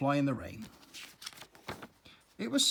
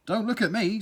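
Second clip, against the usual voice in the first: scowling; 6 semitones higher, 5 dB louder than usual. Acted. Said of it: "scowled Daddy".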